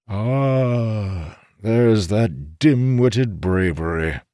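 put-on a commanding old sage; old codger voice. A narrator's reading. Codger scoffing.

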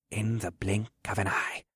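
put-on quiet old mentor prompting; soft muttering. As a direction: mutter it softly like an incantation. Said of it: "Harold muttered softly".

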